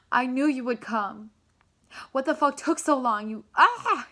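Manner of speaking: overwhelmed tearful-joy reunion cry; emotional reunion outburst. Pretend. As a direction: yell out the joy and the grievance together. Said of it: "happy, emotional, mad, everything's gonna come up".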